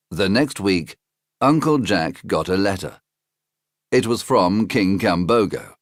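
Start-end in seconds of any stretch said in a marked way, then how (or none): none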